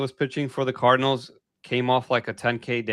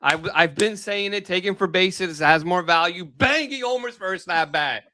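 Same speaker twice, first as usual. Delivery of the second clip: excited; 6 semitones higher than usual. Real, not acted. Celebrating his called pick.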